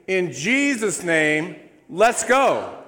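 slow, loud, high rallying cry; triumphant preacher shout; rousing shout. Real, not acted. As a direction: fire up the congregation. Rousing end of declaration.

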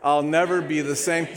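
booming church declaration; loud group declaration. Acted. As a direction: recite it loud and bold like a creed. Congregation Bible declaration recited.

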